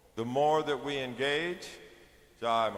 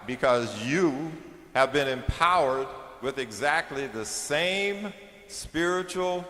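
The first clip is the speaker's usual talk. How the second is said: louder, driving sermon voice; forceful preaching; 5 dB louder than usual. Acted. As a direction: press the point home to the crowd.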